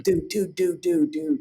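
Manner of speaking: high, drawn-out surfer dude call. Retold or imitated. Imitating his boss the surfer dude, telling him to chill. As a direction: call out like a laid-back surfer. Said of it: "like the surfer dude from Norway".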